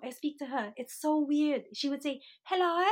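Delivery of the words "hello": imitated Australian hello, higher and louder; Australian accent. Sister in Australia answering a call, imitated Australian accent. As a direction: put on a rough Australian accent. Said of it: "she has an Australian accent".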